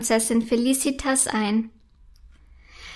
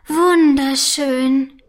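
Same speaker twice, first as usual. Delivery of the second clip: slow, marvelling gasp of wonder; in awe; 4 dB louder, much slower than usual. A narrator's reading. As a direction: breathe it out amazed at the moon. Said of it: "staunt sie".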